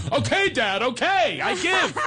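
mock kids caving in; mock-whiny kids saying they give up; kids giving in. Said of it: "they go".

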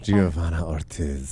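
low, slow, soft savouring of a name; mock seductive. Host relishing caller's friend's name, mock seductive. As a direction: purr the name like a late-night lothario.

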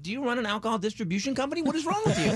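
rising to a high laughing cry of disbelief; incredulous outburst. Retold or imitated. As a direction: pitch the last question way up, laughing in disbelief. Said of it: "I'm like".

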